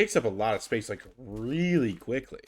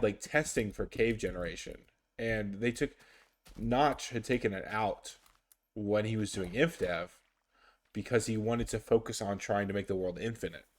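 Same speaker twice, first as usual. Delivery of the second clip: ordinary delivery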